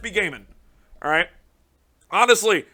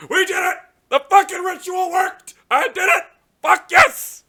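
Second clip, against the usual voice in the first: real victory yells, much higher and louder; triumphant shouting; 13 semitones higher, 7 dB louder than usual. Real, not acted. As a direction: scream it like you finally won. Pulled the card he wanted.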